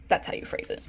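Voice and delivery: breathy self-correcting aside; soft muttered aside; whispered aside. Real, not acted. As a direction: whisper it to yourself. Quiet aside to herself.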